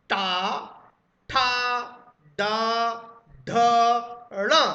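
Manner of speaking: tongue flips back on each one; retroflex letters drilled. Shown as a demonstration. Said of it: "फिर से बोलते हैं". (मूर्धन्य अक्षर ज़ोर से अलग अलग बोलना)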